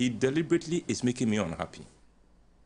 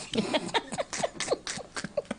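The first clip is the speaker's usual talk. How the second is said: high breathy laugh; 4 dB quieter than usual. Real, not acted. Laughing at forty years.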